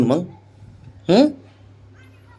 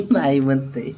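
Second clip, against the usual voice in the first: laughing; 3 dB quieter than usual. Real, not acted. Laughing at baby's refusal.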